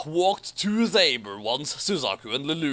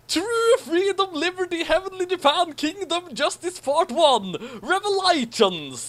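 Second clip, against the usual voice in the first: high, bold proclamation; grand announcing; 12 semitones higher than usual. Acted. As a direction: proclaim each title grandly. Reading grand chapter subtitles.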